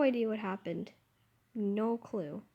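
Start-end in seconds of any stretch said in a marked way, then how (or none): none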